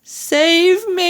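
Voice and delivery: high, rising wail for help; drawn-out high plea; scream. A narrator's reading. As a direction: scream it as a giant in terror. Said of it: "screamed the flesh lump eater".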